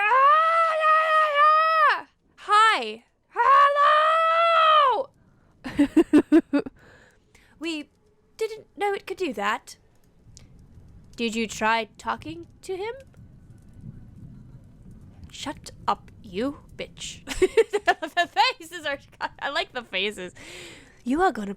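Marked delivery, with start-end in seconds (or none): 0.0-5.1 high, loud excited greeting; excited shout. Acted. Character hailing the creature, shouting high. 15.3-17.2 hushed insult. Acted. Hissed insult.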